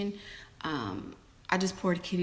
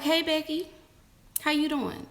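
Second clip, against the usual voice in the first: high, sugary mock-sweet hello; fake friendly; 10 semitones higher, 4 dB louder than usual. Wife greeting the mistress at dinner, fake-sweet.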